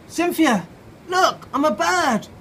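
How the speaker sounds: high, loud excited call, slowing down. Acted. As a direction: shout it out like a proud discovery. Norman calls out excitedly.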